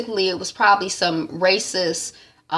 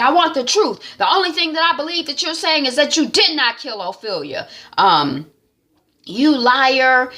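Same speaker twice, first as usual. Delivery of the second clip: higher, pressing interrogator voice; lightly voiced threat, pushing for truth; menacing [name]; 6 semitones higher than usual. [name] threatening to torture Nick, higher menacing voice. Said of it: "[name] was like".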